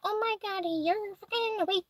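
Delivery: high, slow mock amazement; mock gasp. Mocking the parents with high fake astonishment.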